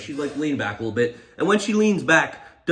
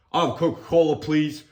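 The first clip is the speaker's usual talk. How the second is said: imitating a self-assured drink order; mock confident. Passenger ordering his drink from the flight attendant, mock confident. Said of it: "he says it so confidently".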